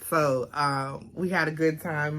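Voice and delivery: ordinary talk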